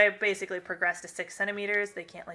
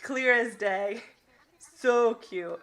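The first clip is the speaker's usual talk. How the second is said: ordinary talk, nothing marked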